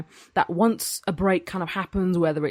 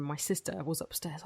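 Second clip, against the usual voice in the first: ordinary talk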